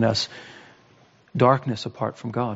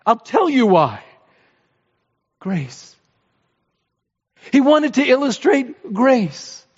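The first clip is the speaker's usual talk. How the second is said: higher, fervent sermon voice driving each point; impassioned preaching; 7 semitones higher than usual. Acted. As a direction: preach it with rising fervor to the congregation. Preacher rises to full force.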